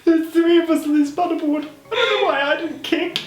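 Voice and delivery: high-pitched talk through real laughter; laughing helplessly. Real, not acted. Recalling three on one paddleboard, helpless laughing. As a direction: squeak it out while cracking up.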